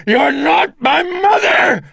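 high, loud, slow furious shout; shouted denial. A narrator's reading. Priest shouting at the demon that it is not his mother. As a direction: scream the denial at her.

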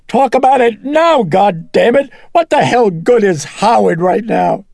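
high, full-voiced angry screaming; furious scream. A narrator's reading. Mother refusing to put off the talk, screaming furiously. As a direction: cut him off at screaming pitch. Mother cuts priest off, raging.

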